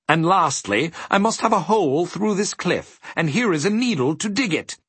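high, courteous gentleman voice; agreeable faceless gentleman. A narrator's reading. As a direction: hand out a chore pleasantly, pitched high.

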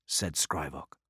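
ordinary delivery

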